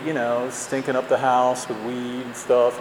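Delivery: ordinary talk, nothing marked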